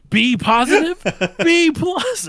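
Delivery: high, loud mock outrage; outraged disbelief. Dad mishearing son's blood type as a grade, outraged. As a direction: explode in hammed-up disbelief.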